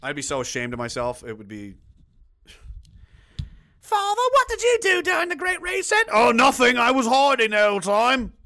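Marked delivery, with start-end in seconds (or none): 3.8-8.3 high, loud mock British child and father; British accent. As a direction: play a British family in a silly high voice. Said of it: "That's a British accent".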